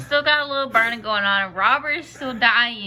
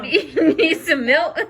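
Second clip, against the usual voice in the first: laughing; 6 semitones higher, 4 dB louder, slower than usual. Real, not acted. Laughs while offering milk.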